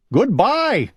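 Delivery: goodbye called out high; a lightly voiced call after someone leaving; a touch of a farewell call. A narrator's reading. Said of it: "called Owl".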